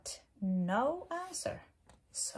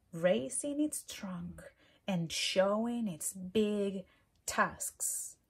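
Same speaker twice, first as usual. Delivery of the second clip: usual speaking voice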